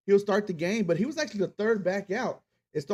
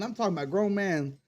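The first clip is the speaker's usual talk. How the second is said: ordinary delivery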